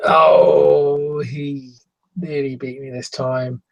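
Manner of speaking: lightly voiced game opponent, big drawn-out opening; a touch of hammed-up grumbling. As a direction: start on a loud "oh", then settle back. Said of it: "he gives the comments of sort of".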